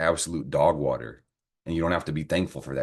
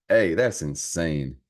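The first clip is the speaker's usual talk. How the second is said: ordinary talk, nothing marked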